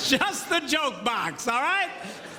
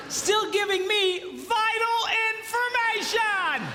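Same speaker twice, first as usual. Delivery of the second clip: mock calling; 10 semitones higher, slower than usual. Mocking wife calling from upstairs.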